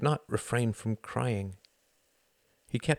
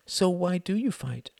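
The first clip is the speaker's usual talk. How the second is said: put-on child asking; 10 semitones higher than usual. A narrator's reading. Jocelyn asking.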